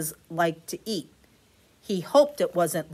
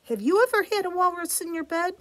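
ordinary delivery

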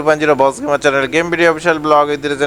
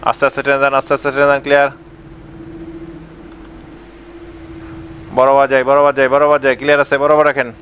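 a lightly voiced bus helper, a touch lower, chanting directions; bus helper calling; 4 semitones lower than usual. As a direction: guide the bus in like a helper on the footboard.

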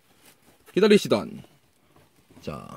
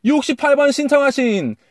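excited announcement; 10 semitones higher, 4 dB louder than usual. Real, not acted. Announcing prize draw winner.

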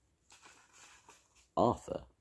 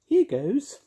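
high, sing-song witchy voice; bright, bossy little witch; 8 dB louder than usual. Acted. Mavis casting spell.

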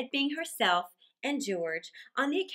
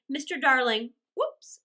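ordinary delivery, nothing marked

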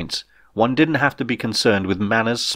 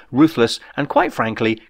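usual speaking voice

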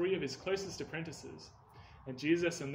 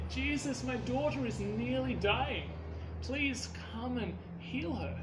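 higher, slow, desperate begging; pleading father; 7 semitones higher, slower than usual. A narrator's reading. Father on his knees begging Jesus to heal his daughter, slow pleading. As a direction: plead like a father whose child is dying.

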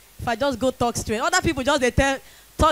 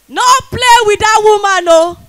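higher and much louder, shouting a warning; 7 semitones higher, 9 dB louder than usual. Acted. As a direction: bellow the warning at the men. Loud warning to the men.

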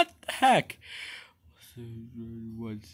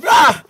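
sharp scream; 11 semitones higher, 6 dB louder than usual. Acted. Creature startled by box.

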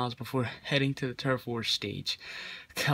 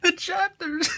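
much higher, squeaky, laughing; high disbelieving laugh; 5 dB louder, slower than usual. Real, not acted. Shocked the story has chapters, squeaky laugh.

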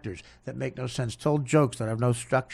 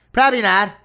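mock Dan reply; 8 semitones higher, 10 dB louder than usual. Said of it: "imitating Dan's answer".

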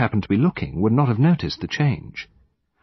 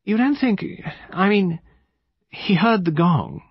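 high, halting, uncertain lady; vague; 10 semitones higher than usual. A narrator's reading. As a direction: grope for the question, pitched high. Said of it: "her manner was vague in the extreme".

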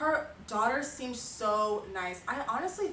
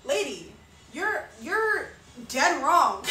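higher, louder mock outrage; retold indignant comeback; defensive outburst; 4 semitones higher, 7 dB louder, slower than usual. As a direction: push back hard, half laughing. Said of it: "I start getting defensive too".

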